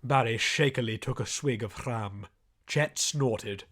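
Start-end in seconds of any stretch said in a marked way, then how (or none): none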